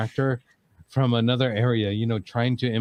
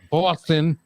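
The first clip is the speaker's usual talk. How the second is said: slow, higher Boston accent on "Boston", R dropped; 6 semitones higher, 4 dB louder than usual. Shown as a demonstration. Hosts showing off the local accent, slow Boston drawl. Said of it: "my accent".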